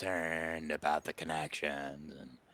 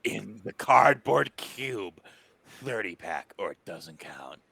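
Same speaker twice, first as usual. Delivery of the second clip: drawn-out, raised-voice stipulation; emphatic demand; 3 semitones higher, 9 dB louder, slower than usual. Acted. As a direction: lay down the terms grandly, word by word. Character dictating terms of his fee.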